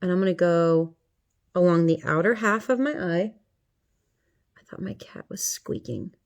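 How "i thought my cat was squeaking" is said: softer, whispered aside; hushed whisper. Real, not acted. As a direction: drop to a whisper as if listening for the cat. Aside about a noise, hushed.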